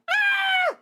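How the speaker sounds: loud, very high scream; ear-splitting excited shriek; hammed-up fangirl scream; fangirl squeal.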